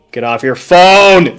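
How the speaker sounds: loud, drawn-out call; mock scolding shout. Real, not acted. Game master to a player on the phone, scolding shout. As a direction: holler at someone not paying attention, stretching it out.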